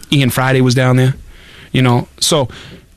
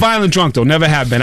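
ordinary delivery

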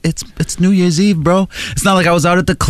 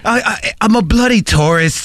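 stammering high mock British tourist; hammed-up English accent; 6 semitones higher than usual. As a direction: stutter it like a flustered Brit. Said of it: "And he kept going".

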